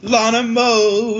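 high, drawn-out mock announcement; playful high announcer call. Echoes the named mode as announcement.

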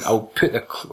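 usual speaking voice

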